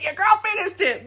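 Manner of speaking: put-on high, triumphant repeat with stress on girl; your girl, said with a triumphant lift on girl; proud brag. Proud announcement, said twice.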